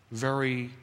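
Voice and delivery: ordinary talk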